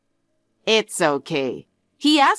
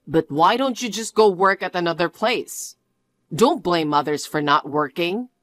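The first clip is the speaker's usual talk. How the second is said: ordinary delivery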